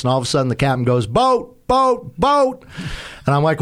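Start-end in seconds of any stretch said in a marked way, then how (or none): 1.1-2.6 high, urgent repeated call; captain's sharp high warning. Retold or imitated. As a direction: alert him to a boat ahead. Captain calling out a boat.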